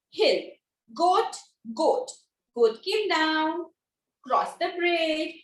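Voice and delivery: "hill", "goat" set apart by short pauses; slow and steady, a small pause after "hill" and each "goat"; minute pauses. Shown as a demonstration. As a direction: stretch "came down" and "cross the bridge", pausing briefly between. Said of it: "I take a very minute pause".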